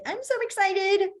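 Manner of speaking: high, eager burst of excitement; excited. Shown as a demonstration. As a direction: sound thrilled, pitch jumping up. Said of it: "So you're going excited".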